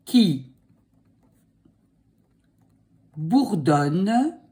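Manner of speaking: dictating. Shown as a demonstration. Dictating slowly.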